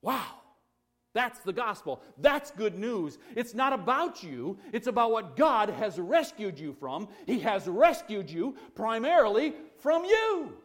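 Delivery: loud, high-pitched preaching at full force; impassioned preaching. Real, not acted. Preacher telling the congregation God has rescued them, impassioned preaching. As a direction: drive each phrase out emphatically.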